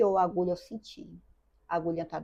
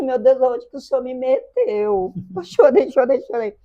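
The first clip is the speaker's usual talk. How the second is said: crying; 6 semitones higher, 11 dB louder than usual. Real, not acted. Chorando ao lembrar a crítica.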